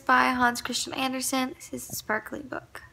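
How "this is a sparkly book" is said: hushed, breathless reveal; soft, delighted whisper over the pretty cover; awed whisper. Real, not acted. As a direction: whisper it like a precious secret. Showing off a sparkly book.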